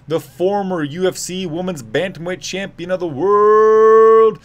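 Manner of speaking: loud, high, stretched ring intro; Bruce Buffer call. Retold or imitated. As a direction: drag each name out, booming. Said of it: "Bruce Buffer has the microphone".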